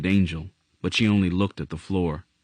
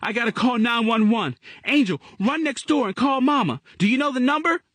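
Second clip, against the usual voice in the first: put-on a scared kid taking charge; quick, anxious child voice; urgent young boy. A narrator's reading. Gerald voiced in a crisis.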